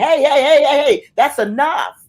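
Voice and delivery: high, loud hey hey calling out; mock bystander shout. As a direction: break up the fight from the crowd.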